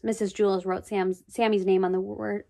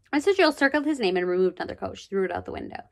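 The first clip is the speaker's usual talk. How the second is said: plain reading voice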